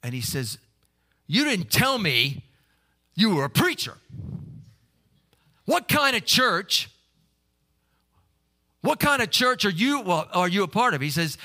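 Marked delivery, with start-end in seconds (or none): 1.3-11.0 corner him, loud and wary; the cook's loud, edgy confrontation. Retold or imitated. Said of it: "kind of a little edgy".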